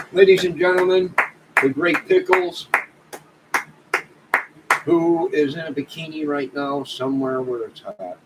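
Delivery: hammed-up announcer build-up; mock announcer. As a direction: boom it out like presenting a star. Announcing a chatter like a host.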